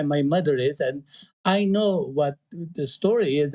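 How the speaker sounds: normal talking voice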